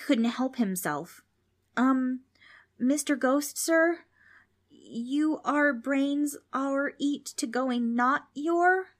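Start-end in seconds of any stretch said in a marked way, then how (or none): 1.8-8.9 high, hesitant, halting voice; timid question. A narrator's reading. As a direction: ask the ghost nervously, word by slow word. Timid boy questions the ghost.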